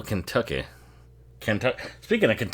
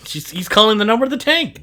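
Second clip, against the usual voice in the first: shouting at the screen; 11 semitones higher, 7 dB louder than usual. Real, not acted. Yelling at character on screen.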